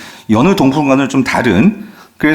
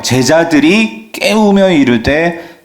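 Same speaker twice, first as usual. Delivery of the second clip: ordinary talk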